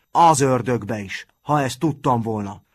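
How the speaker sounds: ordinary delivery, nothing marked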